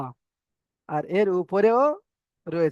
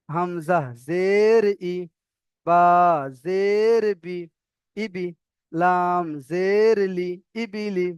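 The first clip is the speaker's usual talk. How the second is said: long, swelling "জের", short "ই"; spelling demonstration; much slower than usual. Shown as a demonstration. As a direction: recite slowly, stretching "জের" in an arch. Teacher models letter-by-letter spelling.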